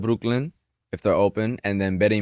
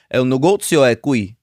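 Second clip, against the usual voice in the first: Italian accent; 2 semitones higher, 5 dB louder than usual. Shown as a demonstration. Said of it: "Let me hear it".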